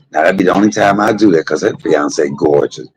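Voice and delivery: normal talking voice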